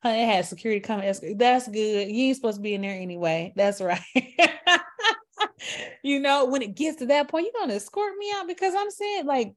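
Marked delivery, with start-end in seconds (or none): 4.1-5.2 a high, loud burst of laughter. Real, not acted. Laughing at her own joke to viewers, loud high laugh. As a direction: cackle at your own joke.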